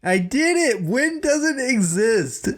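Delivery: high, slow goofy declaration; mock conspiracy nut. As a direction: proclaim it like a crackpot. Mock confession as wind denier.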